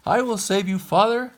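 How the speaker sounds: high, loud heroic call. Acted. Pinocchio calls to his trapped father, high and loud. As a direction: call down to him bravely. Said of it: "called Pinocchio".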